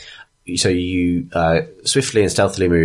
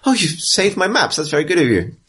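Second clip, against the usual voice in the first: grateful councilwoman; 10 semitones higher than usual. Acted.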